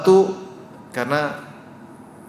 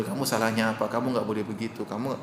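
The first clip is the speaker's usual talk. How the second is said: put-on softer, gentle questioning of a child; calm correction; 4 semitones lower, 7 dB quieter than usual. Parent calling the child over, speaking softer and calm. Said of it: "Kita panggil".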